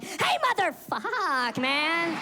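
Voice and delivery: high, loud, drawn-out greeting; hammed-up nervous buddy greeting; sudden fake friendliness. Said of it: "They was like".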